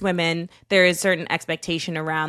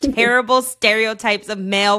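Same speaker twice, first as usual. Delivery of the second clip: higher-pitched, indignant groaning complaint; worked-up, high-pitched protest; exasperated outburst; 5 semitones higher, 4 dB louder than usual. Retold or imitated. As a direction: vent in exasperation about a hated commercial. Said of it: "I just am like".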